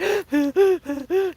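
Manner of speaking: sharp high frightened gasp; a panicked intake of breath. Retold or imitated. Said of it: "having a panic attack".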